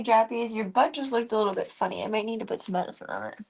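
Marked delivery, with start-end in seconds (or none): none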